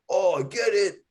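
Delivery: a light sarcastic impression; mocking the buyer.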